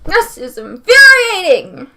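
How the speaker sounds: fed up with the episode, shouting; angry shout. Real, not acted. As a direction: let it out, spelling out each word.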